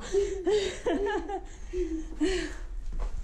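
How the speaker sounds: breathy laugh. Real, not acted. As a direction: let a long laugh roll out. Laughing at the joke.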